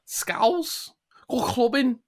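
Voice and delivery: very high-pitched put-on Scouse; hammed-up Liverpool accent, way up high. Shown as a demonstration. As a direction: squeak it out in exaggerated Scouse. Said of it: "you can do a Scouse one".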